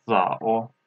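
"za o" dipping low, then rising; third tone demo. Shown as a demonstration. Teacher shows the third tone, slow dipping "za o". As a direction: stretch "za o" through a dip. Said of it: "this is the third tone".

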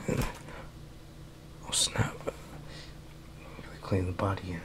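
hushed whisper mid-sneak. Real, not acted. Player sneaking through the game, whispering to himself. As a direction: breathe the words so no one hears.

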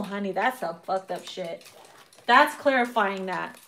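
usual speaking voice

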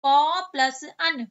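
teacher dictating each piece slowly; slow split. Shown as a demonstration. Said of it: "क्या हो गया".

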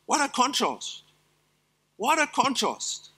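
usual speaking voice

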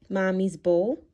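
usual speaking voice

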